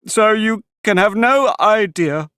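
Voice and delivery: high, slow, loud turtle voice; Mock Turtle voice. A narrator's reading. Mock Turtle tells Alice of the quadrille, high and slow.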